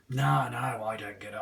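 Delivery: normal talking voice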